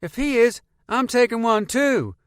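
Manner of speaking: put-on high, loud little boy; kid voice. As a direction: pipe up like a small kid. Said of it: "Donovan said".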